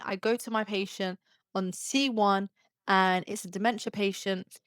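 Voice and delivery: ordinary delivery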